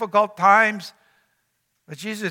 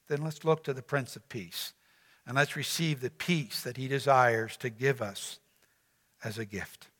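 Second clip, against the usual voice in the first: much softer, lower, fading to near hush; preacher softly inviting to the Prince of Peace; hushed close; 7 semitones lower, 11 dB quieter than usual. Real, not acted. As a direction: draw them in quietly.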